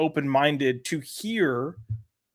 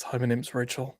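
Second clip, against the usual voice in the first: lightly voiced low, quiet character; a hint of a deep-voiced introduction; put-on Rachel voice; 3 semitones lower, 8 dB quieter than usual. Shown as a demonstration. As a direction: drop into a subdued put-on voice. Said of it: "Rachel could talk like this".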